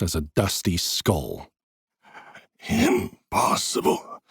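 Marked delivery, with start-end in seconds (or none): 2.7-4.2 slow, breathless rasp; raspy whisper. A narrator's reading. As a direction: gasp it out dying. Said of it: "he rasped, his voice barely a whisper".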